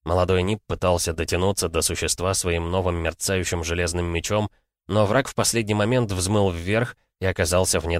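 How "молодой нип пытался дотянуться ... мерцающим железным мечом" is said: plain reading voice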